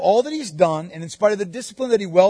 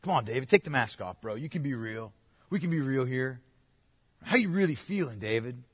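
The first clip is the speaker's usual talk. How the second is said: soft, coaxing bro voice, much quieter; 5 semitones lower, 8 dB quieter than usual. Retold or imitated. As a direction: lean in and coax him to open up. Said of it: "tempted to say to David, like".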